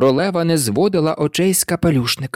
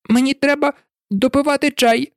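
higher, worried plea; eager to escape; high, nervous request; high anxious hatter; 11 semitones higher than usual. A narrator's reading. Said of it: "промовив капелюшник".

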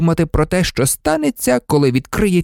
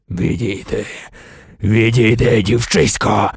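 a hint of a cold villain; 2 semitones lower than usual. A narrator's reading. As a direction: command her aside with menace. Voldemort orders mother aside.

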